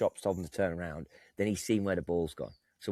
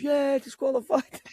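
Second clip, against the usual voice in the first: mock shouted agreement; 7 dB louder than usual. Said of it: "Someone else says".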